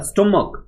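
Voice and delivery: "stomach" with a final k sound; correct pronunciation. Shown as a demonstration. Teacher repeating the right word for students, hard-k "stomach". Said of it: "इसको स्टमच नहीं पढ़ते".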